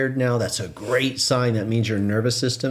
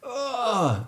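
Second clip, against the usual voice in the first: stretching groan; 12 semitones higher than usual. Retold or imitated. Imitating a dog stretching.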